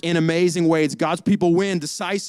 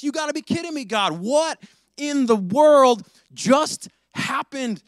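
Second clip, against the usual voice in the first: crying out to God; 6 semitones higher than usual. Acted. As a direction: cry out in disbelief, pitch climbing. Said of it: "he cries out".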